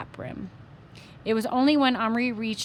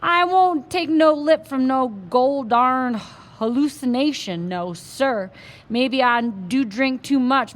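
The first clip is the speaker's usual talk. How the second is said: loud, slow self-pitying Texas cowboy; a drunk cowboy ranting in Texas twang; louder, slow, aggrieved Texas accent; 5 dB louder than usual. Acted. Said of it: "in his strong Texas accent".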